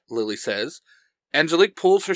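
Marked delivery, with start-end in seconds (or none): none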